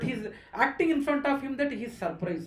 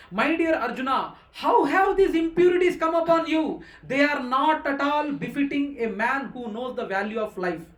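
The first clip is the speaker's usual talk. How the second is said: Krishna's rebuke; 3 semitones higher, 7 dB louder than usual. Acted. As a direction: rebuke Arjuna as the Lord in surprise. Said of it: "The Supreme Personality of Godhead said".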